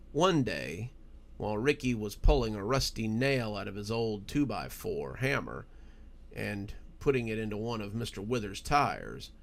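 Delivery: ordinary delivery, nothing marked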